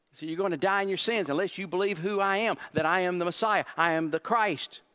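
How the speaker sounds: building sermon climax; full-force preaching. Acted. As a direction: drive the warning home to the crowd. Preaching climax, repeated warning.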